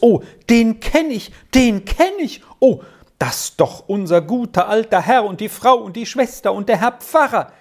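high, exclaiming in happy disbelief; overjoyed recognition. A narrator's reading. Old Trine greeting the family she knows, exclaiming overjoyed.

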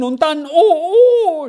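high, long-drawn wail; crying out. A narrator's reading. Girl relives being shut in the cupboard, crying out. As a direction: let the words swell into a high wail. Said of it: "schrie Squirrel auf".

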